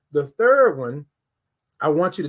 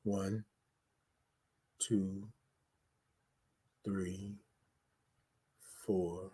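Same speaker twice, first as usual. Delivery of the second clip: much softer, lower count held through the breath; 8 semitones lower, 17 dB quieter, much slower than usual. Shown as a demonstration. Host counting viewers through a deep breath, very softly. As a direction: count gently while they hold the breath. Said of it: "I'm gonna count it out".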